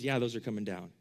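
low, soft, matter-of-fact letdown; dry deflating reply; deadpan. Acted. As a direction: burst their bubble in a low, casual voice. Jesus deflates admirers of towers.

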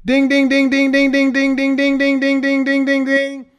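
high, loud ding-ding bell imitation; bell ding sound. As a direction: ring out like a game-show bell.

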